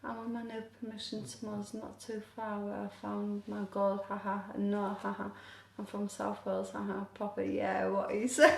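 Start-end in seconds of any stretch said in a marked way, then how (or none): none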